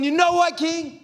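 high, exasperated outburst at the king; fed up, defiant. Acted. Worn-out captive daring the king to kill him, fed up. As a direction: throw it back, past caring. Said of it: "I am tired of it".

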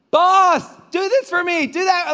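high-pitched mock pestering of the boss; needy employee. Acting a needy worker calling the boss.

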